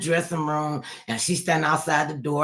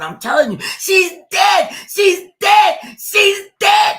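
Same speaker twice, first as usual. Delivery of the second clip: sister's panicked scream, much higher and louder; retold screaming; 10 dB louder, slower than usual. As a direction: scream it down the phone, she's dead. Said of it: "you could hear her screaming in the phone".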